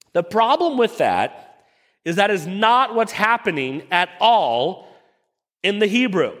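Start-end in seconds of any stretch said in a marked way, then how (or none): none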